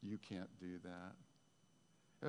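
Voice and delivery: very slow, hushed, low voice; a drawn-out, discouraging murmur from memory; voice from the past. Acted. Preacher voicing a doubt from the listener's past, hushed and slow. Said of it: "you hear a voice from your past".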